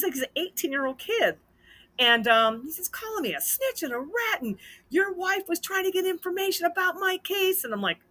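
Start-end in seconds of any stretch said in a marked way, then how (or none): 2.9-7.6 high-pitched imitation of an upset accusation; retold agitated complaint. Husband relays kid's accusations to wife, agitated complaint. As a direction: reel off the accusations high, mock-aggrieved.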